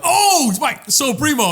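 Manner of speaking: high, excited greeting; delighted recognition. Retold or imitated. Replaying meeting podcast fans, delighted recognition. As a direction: act suddenly thrilled to know them.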